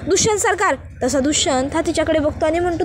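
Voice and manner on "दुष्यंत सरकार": calls out his name loudly and high; calling across the room to her husband. A narrator's reading. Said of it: "जोरात हाक मारते". (नवऱ्याला मोठ्याने हाक मारणारी)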